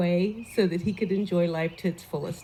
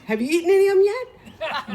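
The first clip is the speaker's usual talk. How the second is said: higher, loud; Lenny asking; 10 semitones higher, 5 dB louder than usual. Retold or imitated. Lenny questioning the neighbours. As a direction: ask with a hint of a prank. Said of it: "He goes".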